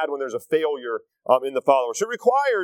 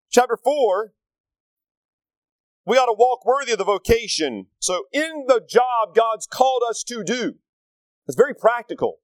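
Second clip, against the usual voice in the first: preaching; 4 semitones higher than usual. Acted. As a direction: drive the scripture home. Preaching scripture with force.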